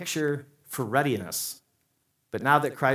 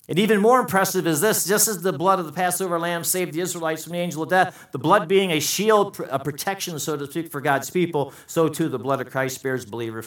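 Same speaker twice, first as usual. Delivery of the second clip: raised, louder pulpit voice; full-force preaching; 6 semitones higher, 5 dB louder than usual. Real, not acted. Preacher to congregation on the Passover blood, forceful preaching.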